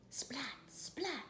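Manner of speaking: unvoiced splat; whispered splat. Shown as a demonstration. Recapping for a child, whispering splat. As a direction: breathe the splats. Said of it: "making a noise like that".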